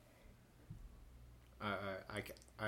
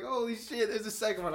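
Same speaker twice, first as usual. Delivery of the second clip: high, loud mock astonishment; retold shock; 10 semitones higher, 6 dB louder than usual. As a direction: relive finding the second one. Retold shock at second chipmunk.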